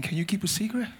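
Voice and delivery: low-key, softly spoken question; quiet, rising question. Shown as a demonstration. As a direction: say it softly, voice still on. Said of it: "Say it softer".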